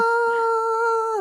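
very high, drawn-out yell; long sustained yell. Shown as a demonstration. Friend shows how the heroine yelled, long high yell. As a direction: hold one long high "ah". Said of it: "it's more of a yell".

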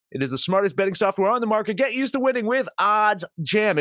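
upbeat sponsor ad read. Acted. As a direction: sell it like a podcast ad.

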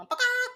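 high-pitched chicken squawk; quick imitated hen call; chicken cluck. Shown as a demonstration. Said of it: "I say chicken, you cannot go".

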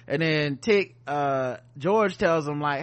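usual speaking voice

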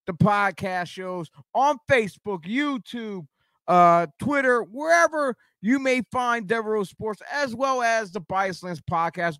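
upbeat promo read of socials; podcast host outro. Acted. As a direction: plug the channels like an announcer. Host plugs socials at the end.

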